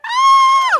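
very high, very loud shriek of joy; triumphant shriek. Retold or imitated. Shrieking as she finally balances in the foyer, loud. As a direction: scream it like you finally did it. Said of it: "I screamed".

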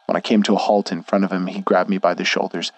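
ordinary delivery

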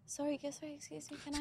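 tiny, high, very soft apology; acting out a meek, apologetic passenger; begging. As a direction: squeeze out a timid, hushed plea. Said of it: "what makes me feel like I'm begging".

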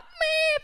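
high, loud crying whine; child whimper. Retold or imitated. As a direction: imitate the kid bursting into tears. Child starting to cry.

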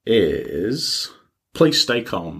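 normal talking voice

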